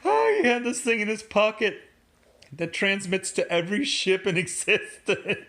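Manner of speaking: amused laughing delivery; laughing while talking. Real, not acted. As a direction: still laughing as you tell it.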